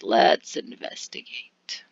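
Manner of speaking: whispered, slow and low; a slow hushed whisper with no voice. Shown as a demonstration. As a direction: breathe let's investigate as a secret.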